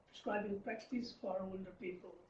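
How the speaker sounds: usual speaking voice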